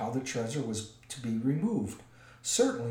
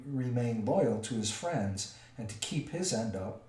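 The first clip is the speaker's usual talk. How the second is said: plain reading voice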